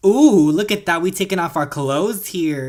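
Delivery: loud, high teasing ooh; excited tease. Real, not acted. Gamer watching her sims undress, loud high teasing hoot. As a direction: hoot at the screen, loud and delighted.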